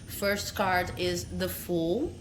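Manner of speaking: ordinary talk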